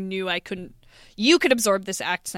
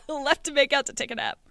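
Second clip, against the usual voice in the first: higher, amused disbelief after a laugh; amused repeat; 6 semitones higher than usual. Real, not acted. Friend teasing about leaving for a nap, amused. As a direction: repeat it, tickled and incredulous.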